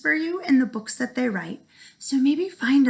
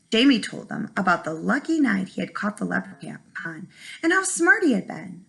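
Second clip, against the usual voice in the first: plain reading voice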